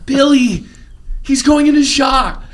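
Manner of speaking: hammed-up panic over the radio; frantic movie quote. Quoting movie line again.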